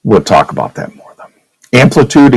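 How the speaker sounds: ordinary delivery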